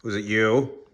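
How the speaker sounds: loud, accusing demand to the dog; stern loud question. Real, not acted. To the guilty dog, a loud accusing question. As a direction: bark the question at the culprit.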